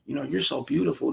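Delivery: soft, hesitant, timid compliment; a hint of a nervous, mumbled compliment; mocking a weak compliment. Shown as a demonstration. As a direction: say it shyly, like a man afraid of her. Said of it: "don't call it out".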